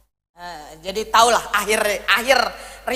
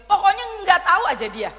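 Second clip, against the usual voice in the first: usual speaking voice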